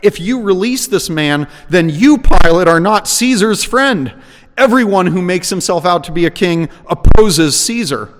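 loud, high-pitched public accusation; crowd crying out. A narrator's reading. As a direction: raise the voice in forceful warning.

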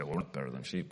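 soft grumbling protest from the pews; a low, quiet aside from a sceptic; imagined objection. Pretend. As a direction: mutter it low and soft, as a doubting listener. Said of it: "You say".

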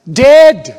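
a sudden loud, high shout of one word. Real, not acted. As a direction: slam the word Dead like a hammer. Preacher hammers home the word.

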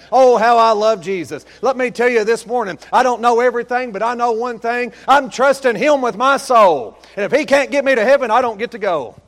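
high, loud, fervent preaching; preaching at full force. Acted. Preacher rousing the congregation, shouting fervently. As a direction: lift the whole church with it.

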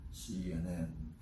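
very low, slow announcer voice; soft, deep station-ident voice; network announcer impression. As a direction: drop deep and spell it out slowly. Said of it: "that voice".

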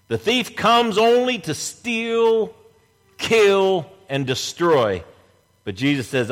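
ordinary delivery

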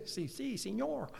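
a joking pun in fake Spanish; mock Spanish. Preacher joking to the congregation in a hint of mock Spanish. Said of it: "That was my Spanish lesson for the day".

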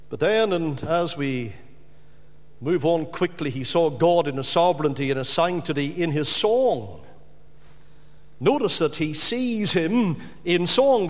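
ordinary delivery

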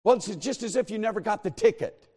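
quick, matter-of-fact clerk; brisk clerk. Retold or imitated. Clerk correcting him briskly, matter-of-fact. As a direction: rattle it off like an official. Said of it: "She says".

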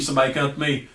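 normal talking voice

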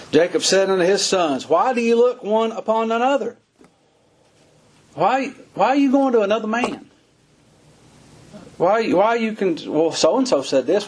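fiery Southern preacher in full flow; full-force preaching. Acted. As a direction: preach it at the top of the voice, urging the crowd.

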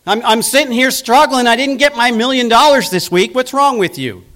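high, loud, fast petulant whine; mock indignant complaint to God. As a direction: complain to God like a spoiled child. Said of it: "it's like".

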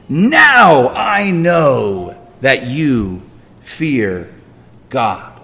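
very slow, drawn-out scripture quote; spoken slowly, word by word; slow, deliberate quote. Acted. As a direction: stretch each word out with weight. Reading out the angel's words slowly.

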